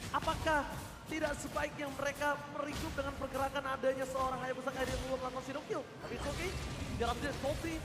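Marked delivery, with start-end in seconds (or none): none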